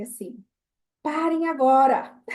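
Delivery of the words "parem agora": loud, firm motherly command, slow and higher; tom alto e firme. Retold or imitated. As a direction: imitate a mother's stern order, drawn out loud. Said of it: "fala num tom alto e firme". (bronca em voz alta e firme)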